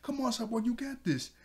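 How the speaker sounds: root for a teammate in a high voice; cheering on. Retold or imitated. Titans encouraging Cyborg as he lifts, imitated high cheering. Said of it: "they start to cheer on Cyborg".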